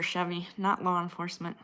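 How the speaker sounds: ordinary talk, nothing marked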